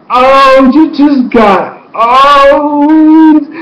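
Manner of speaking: high, loud, drawn-out jeer; mock taunting. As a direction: crow over someone's humiliation. Re-enacts taunting reaction.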